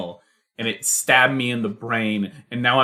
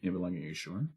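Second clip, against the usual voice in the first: much softer seagull mooch; 4 dB quieter, much faster than usual. Acted. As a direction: wheedle for food quietly as a seagull. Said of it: "the seagull looks on to her and says".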